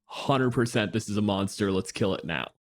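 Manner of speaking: ordinary delivery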